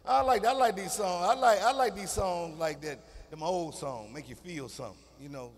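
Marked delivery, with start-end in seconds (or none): none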